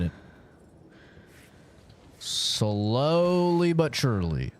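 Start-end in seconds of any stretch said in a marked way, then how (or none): 2.2-4.5 slow, with weight on "slowly"; stress on slowly. Shown as a demonstration. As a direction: draw out "slowly" deliberately. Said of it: "Emphasis on slowly".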